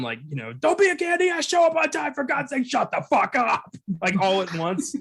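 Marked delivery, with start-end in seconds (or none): 0.5-3.7 high-pitched mock screaming rant. Director screaming at Vin Diesel, high mock yell. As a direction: shriek it all out at once, hammed-up. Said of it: "just starts screaming at him".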